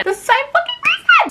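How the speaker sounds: very high, exasperated cry; indignant outburst. Real, not acted. Complaining to viewers about being banned, outraged. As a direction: shout it up in disbelief.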